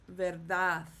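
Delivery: verdad said ver-DAD; stress on last syllable. Shown as a demonstration. Teacher showing a word ending in D, verdad stressed last. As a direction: land on the final DAD of verdad. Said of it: "so it's pronounced".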